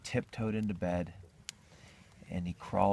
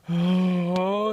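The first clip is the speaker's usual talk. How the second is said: loud gasp into a high "oh"; hammed-up breathing through contractions; big labour breath; 10 semitones higher, 9 dB louder than usual. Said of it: "Dick gives a couple big".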